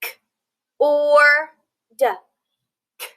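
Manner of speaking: K, or, d sounded out one by one, cut apart; sounding out sounds. Shown as a demonstration. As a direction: break cord into K, or, d. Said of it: "Now let's sound out the syllables in cord".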